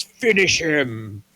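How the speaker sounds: drawn-out, high game announcer call; game announcer yell. Acted. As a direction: stretch it out like a fighting-game announcer. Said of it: "the screen yells".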